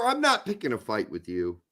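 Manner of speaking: normal talking voice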